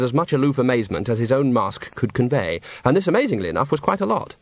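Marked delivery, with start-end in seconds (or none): none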